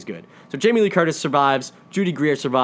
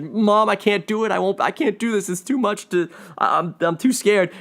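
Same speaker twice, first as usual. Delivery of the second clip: higher, mildly tearful plea, lightly acted; imitating tearful pleading; 7 semitones higher than usual. Reviewer retelling the daughter's plea to her mom, mildly tearful. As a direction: play the scared daughter begging, a touch of tears. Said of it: "she starts crying and really, like, s-, upset".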